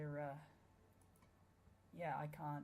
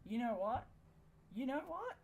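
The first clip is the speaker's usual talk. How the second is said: each repeat more outraged, up to a shout; rising exasperated yell; 8 semitones higher, 6 dB louder than usual. Real, not acted. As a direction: voice climbing higher and louder, fed up. Building frustration at game.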